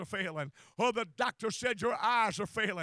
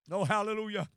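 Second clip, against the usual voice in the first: ordinary talk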